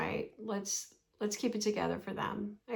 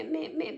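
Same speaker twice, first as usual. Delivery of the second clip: a dismissive nagging meh, trailing off; sneering mimicry of petty chatter; mock bickering; 5 semitones higher than usual. Mocking women's petty bickering.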